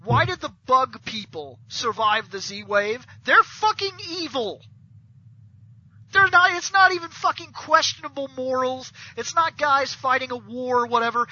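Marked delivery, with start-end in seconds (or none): 3.2-8.9 high-pitched, exasperated insistence; indignant rant. Real, not acted. Fan arguing about evil bug people, indignant and high. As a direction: protest it in heated disbelief. Ranting at the show's plot hole.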